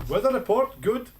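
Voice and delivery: mock Guru, lightly voiced; imitating Guru. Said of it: "I forgot about Guru and his weather reports".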